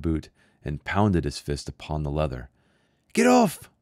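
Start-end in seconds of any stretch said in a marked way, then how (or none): 3.1-3.7 put-on high, loud child's shout; boy shouting. A narrator's reading. A boy shouting at the stranger's boot. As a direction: yell it like a frightened boy. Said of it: "the boy shouted".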